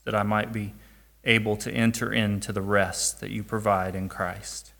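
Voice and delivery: soft, low closing prayer. Real, not acted. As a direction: pray quietly and humbly.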